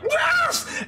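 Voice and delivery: very high creature screech; startled satyrs. Acted. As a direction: shriek it like a little satyr. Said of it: "satyrs look at each other like".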